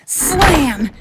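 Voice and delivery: loud, high sound-effect word; loud slam. A narrator's reading. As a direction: punch out the slam. Narrated door slam sound.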